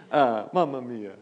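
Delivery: hammed-up Italian exclamation; playful mock Italian accent. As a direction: throw in a jokey Italian accent. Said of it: "that's more Italian, isn't it?".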